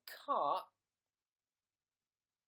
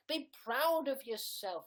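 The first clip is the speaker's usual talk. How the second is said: mock rallying cry; 10 semitones higher, 5 dB louder than usual. Cheering on gingers.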